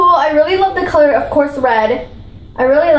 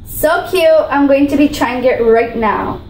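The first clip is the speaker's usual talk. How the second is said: ordinary delivery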